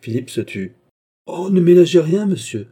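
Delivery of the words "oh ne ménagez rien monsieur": loud, high outburst that drops on the last word; angry insistence. A narrator's reading. Queen pressing Philippe to hold nothing back, angry insistence. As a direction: burst out, much louder and higher.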